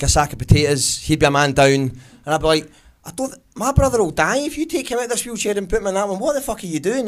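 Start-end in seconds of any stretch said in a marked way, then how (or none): 3.1-7.1 high-pitched, alarmed objection; agitated, raised-pitch outburst; stressed protest. Acted. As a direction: protest as if his life depends on it. Stressed protest to wheelchair man.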